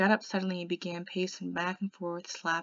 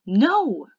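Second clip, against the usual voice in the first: loud, higher-pitched protest; screamed; 4 semitones higher, 15 dB louder than usual. A narrator's reading. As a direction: shout it out in sudden protest. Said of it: "I screamed at him".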